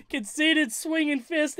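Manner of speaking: quoting the line through laughter; slow, giggling repeat of the punchline; cracking up mid-quote; laughing. Real, not acted. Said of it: "make me laugh like that".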